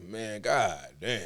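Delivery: slow, drawn-out groan of annoyance; exasperated drawl. Retold or imitated. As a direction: stretch it out, fed up. Reacting to hospital calling mom.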